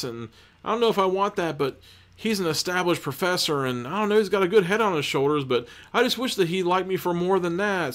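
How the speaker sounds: high-pitched mock romance chatter; soap-opera girlfriend. Girlfriend confiding in friend about her boyfriend, high mock chatter. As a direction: run on in a high voice, fretting over a boyfriend.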